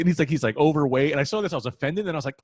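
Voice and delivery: normal talking voice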